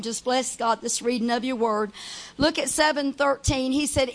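ordinary delivery

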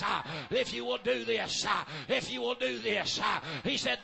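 rhythmic whooping preacher cadence; full-force preaching. Acted. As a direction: build it back up, chanting each phrase. Preaching builds again.

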